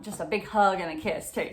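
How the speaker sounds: ordinary talk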